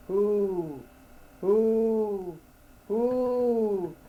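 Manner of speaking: high, drawn-out owl hoot. A narrator's reading. Owl answering the lost man, high hooting. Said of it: "says he again".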